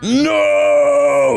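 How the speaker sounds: high, loud wailing no; mock anguished shout. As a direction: howl it in mock despair. Friend protesting news.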